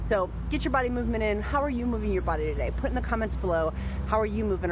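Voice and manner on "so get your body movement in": normal talking voice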